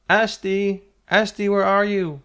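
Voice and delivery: high, loud searching shout; calling out. A narrator's reading. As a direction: call for a missing child far away. Searching parents' voices calling out.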